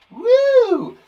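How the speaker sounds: high, loud cheer; excited woo. Real, not acted. Teacher cheering pupils for a long word. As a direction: celebrate out loud.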